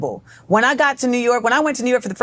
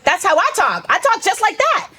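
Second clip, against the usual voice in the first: much higher, delighted exclamation; excited recognition; 9 semitones higher than usual. Retold or imitated. Twelve-year-old hearing people talk like her, excited recognition. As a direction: exclaim it, pitch climbing high. Retold thrilled recognition of own speech.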